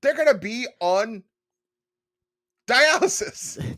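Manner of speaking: high, loud, drawn-out last word; dramatic outcry. Acted. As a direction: stretch the last word in mock horror. Mock outrage at liver dish.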